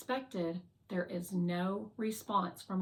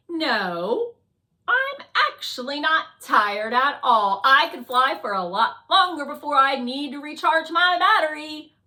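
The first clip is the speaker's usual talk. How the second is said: loud, higher-pitched protest; eager drone Fly; 6 semitones higher, 11 dB louder than usual. Acted. Fly denies being tired to Guardian, loud and indignant. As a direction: insist brightly at full volume.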